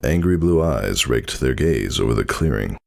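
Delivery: ordinary delivery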